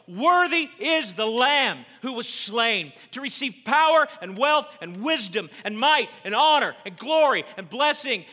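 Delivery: high, shouted acclamation of a heavenly crowd; loud voice. A narrator's reading. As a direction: proclaim each word of praise at full volume. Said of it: "saying with a loud voice".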